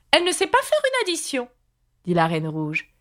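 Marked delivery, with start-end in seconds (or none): 0.1-1.5 high-pitched verdict; disapproving Red Queen. A narrator's reading. Red Queen judging Alice's arithmetic, high disapproving voice. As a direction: pronounce judgement in a high queenly voice.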